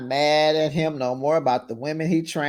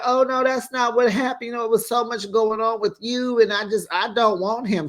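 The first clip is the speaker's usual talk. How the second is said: higher-pitched imitation of a pleading girlfriend; retold denial, pitched up throughout; mock defensive excuse; 7 semitones higher than usual. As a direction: protest in a raised, put-on voice. Acts out her defensive excuse.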